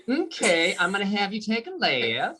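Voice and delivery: put-on sweet country Georgia accent. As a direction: give directions in a folksy Georgia accent. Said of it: "the directions would be all country".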